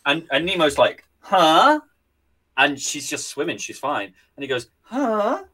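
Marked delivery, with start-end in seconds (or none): 1.2-1.8 confused huh. Acted. Nemo confused by photo. 4.8-5.4 confused huh. Acted. Nemo confused again.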